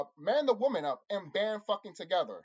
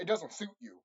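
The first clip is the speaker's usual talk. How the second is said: normal talking voice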